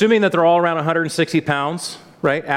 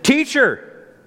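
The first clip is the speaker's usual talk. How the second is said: sarcastic venting; 5 semitones higher than usual. Retold or imitated. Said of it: "a tinge of sarcastic venting".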